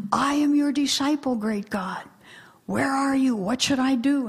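lightly acted devout declaration and appeal; pleading meditator. Meditator pleads with great God, lightly acted devout appeal. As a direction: call out to God as an earnest seeker. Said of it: "the meditator says".

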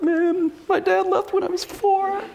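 high, slow, startled realization; mock realization. Recalling realizing why he hates the phone, high startled voice. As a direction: hit the sudden insight like a shock.

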